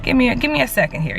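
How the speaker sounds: squeaky high give me a second; retelling her reply to viewers, high and pleading. Retold or imitated. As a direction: ask for patience in a high voice. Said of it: "And I was just like".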